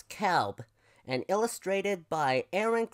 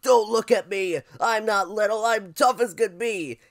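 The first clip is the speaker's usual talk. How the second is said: loud, higher buffalo shout; indignant shout; 6 semitones higher, 9 dB louder than usual. Acted. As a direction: shout defiantly as a huffy little buffalo. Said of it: "Fluff shouted out".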